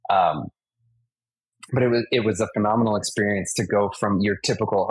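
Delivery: ordinary talk, nothing marked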